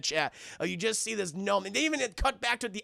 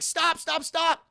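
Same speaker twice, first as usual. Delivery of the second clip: high, insistent mock cry; urgent halting call; 9 semitones higher, 3 dB louder, slower than usual. Mabel halts Jeff the gnome, high mock cry. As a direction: call it out high in even bursts.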